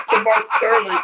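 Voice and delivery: cracking up mid-sentence, high and loud; laughing. Real, not acted. Telling his cohost the punchline while cracking up. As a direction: laugh through it, can barely talk. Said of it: "laughing hard".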